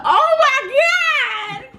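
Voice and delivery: high, slow, drawn-out laughing wail; mock wail. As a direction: cry it out in a hammed-up way. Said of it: "I'm like".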